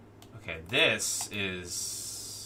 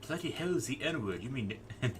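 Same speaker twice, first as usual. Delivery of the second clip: mock Cockney; 5 semitones higher than usual. Streamer repeats the comic's British line, mock Cockney. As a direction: replay the baffled Cockney to yourself. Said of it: "word it in the Cockney accent".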